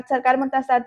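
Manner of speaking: ordinary delivery, nothing marked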